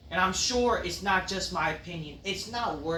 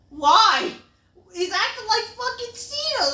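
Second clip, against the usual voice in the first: high, loud frustrated outburst; exasperated yell; 7 dB louder than usual. Real, not acted. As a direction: cry out in disbelief. Exasperated rant.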